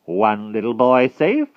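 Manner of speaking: loud, slow, rising count; tally aloud as a gloating witch. A narrator's reading. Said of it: "counted Madam Mim".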